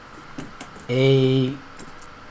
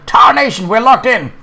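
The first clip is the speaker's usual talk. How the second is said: panicked bandit; 7 semitones higher, 6 dB louder than usual. A narrator's reading. Bandits realise they're trapped.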